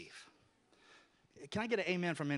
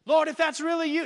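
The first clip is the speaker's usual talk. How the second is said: calling out; 9 semitones higher, 10 dB louder than usual. Acted. Peter calls out to Jesus.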